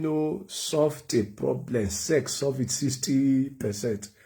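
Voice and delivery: normal talking voice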